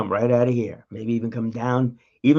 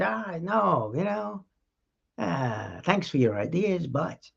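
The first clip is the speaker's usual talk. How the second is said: higher, softer dismissive manager voice; brush-off manager; 6 semitones higher, 4 dB quieter, slower than usual. Retold or imitated. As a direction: politely shut the idea down. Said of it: "what if that manager said".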